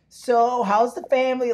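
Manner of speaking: high, loud chirpy dentist small talk; nosy dentist. Retold or imitated. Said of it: "she's like".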